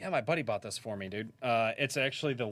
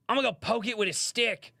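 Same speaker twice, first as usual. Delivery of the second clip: higher, louder excited Australian khaki-shirt showman; hammed-up Australian wildlife host, higher pitched; Steve Irwin impression; 12 semitones higher, 5 dB louder than usual. Streamer retells an Australian comic's Steve Irwin bit, higher, hammed-up Australian. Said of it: "Steve Irwin bit".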